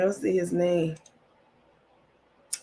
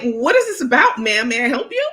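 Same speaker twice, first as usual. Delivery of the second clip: higher, louder, hammed-up formal politeness; mock proper voice; 5 semitones higher, 6 dB louder than usual. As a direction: put on a prissy customer-service voice. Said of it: "y'all know I'm an uppity Negro".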